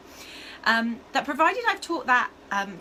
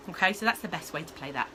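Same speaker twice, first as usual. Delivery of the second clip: normal talking voice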